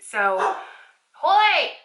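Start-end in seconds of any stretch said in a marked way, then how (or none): 1.2-1.7 loud, high call across the house; shouting a dog's name from another room. Real, not acted. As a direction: yell for the dog to come. Calling the dog from afar.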